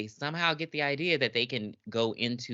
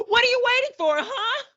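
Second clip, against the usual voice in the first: very high, loud mocking shout; shrill taunting yell; 6 dB louder than usual. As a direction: taunt the character at full pitch.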